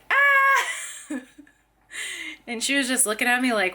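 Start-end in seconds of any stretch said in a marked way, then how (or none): 0.1-1.1 high startled "Ah" breaking into a laugh; retold scream. Mom reenacting her scare at the toddler, laughing yelp. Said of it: "So I was like".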